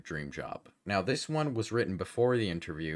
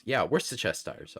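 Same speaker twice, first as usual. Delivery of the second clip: ordinary talk, nothing marked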